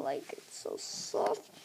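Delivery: hushed whisper over soft fabric. Real, not acted. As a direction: breathe it out in a whisper. Touching soft material.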